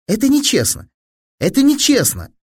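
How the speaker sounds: high-pitched cry of foul; indignant protest. A narrator's reading. Fili objects after missing the serve, high indignant protest.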